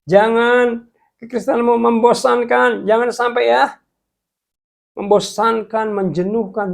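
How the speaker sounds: high, loud pleading warning; fervent warning. Real, not acted. Preacher warns viewers against boring faith, loud fervent plea. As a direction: plead hard with them.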